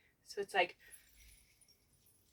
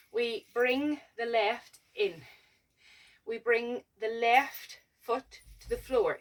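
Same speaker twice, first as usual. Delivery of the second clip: slow, step-by-step teaching voice; slow instruction; slower than usual. Shown as a demonstration. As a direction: talk them through each step slowly. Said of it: "counts steps slowly".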